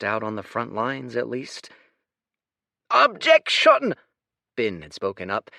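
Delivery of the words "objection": high, loud, slow screech; screeching objection. Acted. Bin objecting over the din, a high loud screech. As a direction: cut through the din with a squawk. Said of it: "screeching to make his voice heard".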